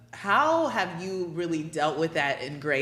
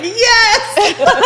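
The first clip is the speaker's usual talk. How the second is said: much higher, louder joyful yes with laughter; excited cheering yes; 13 dB louder than usual. Real, not acted. Host cheering on her guest, laughing and excited. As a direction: cheer it like you can't hold it in. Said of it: "I'm over here like".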